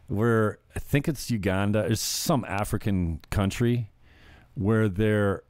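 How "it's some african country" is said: ordinary delivery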